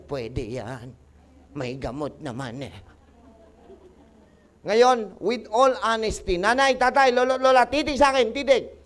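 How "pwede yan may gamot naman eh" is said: low, quiet mock brush-off; dismissive patient. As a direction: mimic a patient casually waving off the warning. Acting out the dismissive patient's reply.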